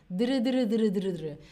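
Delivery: "dr" repeated with the tongue tip trilling behind the teeth; dr drill. Shown as a demonstration. Teacher drills the D-to-R trick, repeated dr trills. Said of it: "haces ahí una R".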